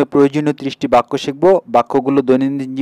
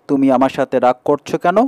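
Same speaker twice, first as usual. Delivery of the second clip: ordinary talk, nothing marked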